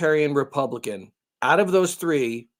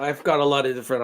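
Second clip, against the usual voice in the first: normal talking voice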